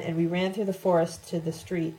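ordinary delivery

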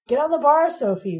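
high, loud coaxing command; half pleading, half ordering. A narrator's reading. Ron calls Sophie onto his bike, half pleading. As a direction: order and beg at once. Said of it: "half pleading, half ordering".

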